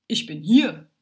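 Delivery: sudden loud announcement in a lightly deep voice; mildly voiced deep-voiced stranger. A narrator's reading. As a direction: announce yourself firmly, a touch low. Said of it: "plötzlich eine tiefe Stimme".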